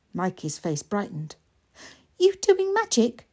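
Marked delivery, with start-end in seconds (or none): 2.2-3.3 put-on high, eager small boy; little boy voice. Mikey asking his sister, high and eager. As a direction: ask it wide-eyed like a small child.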